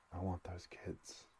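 soft, slow and subdued; quiet. A narrator's reading. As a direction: say it quietly, taking your time. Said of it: "said Welling quietly".

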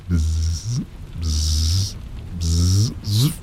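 buzzing bee imitation. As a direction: buzz like a bee at the window.

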